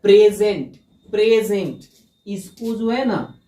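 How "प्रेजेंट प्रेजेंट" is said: Present with the first syllable pray hit loud; present said loud with a falling tune; stress on first syllable. Shown as a demonstration. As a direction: punch pray in present, let the rest fall. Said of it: "इस पे जोर देना".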